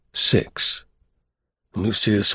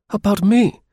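high, louder startled echo; mock astonishment, pitched up; surprised; 10 semitones higher, 4 dB louder than usual. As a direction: feign shock at being named. Lucius startled by the message.

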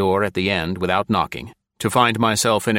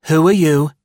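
higher, loud, pettish woman's question; pettish demand; 8 semitones higher, 8 dB louder than usual. A narrator's reading. Blonde secretary demands who the stranger is, pettishly. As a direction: snap it like a spoiled secretary. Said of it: "she demanded pettishly".

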